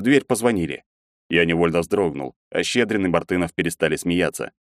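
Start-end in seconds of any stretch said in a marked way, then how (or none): none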